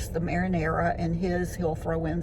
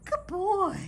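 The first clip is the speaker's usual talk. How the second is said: high, sing-song pet voice; talking to a dog; 9 semitones higher than usual. Real, not acted. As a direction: coo to the dog, high and bright. Greeting her dog Biscuit at home.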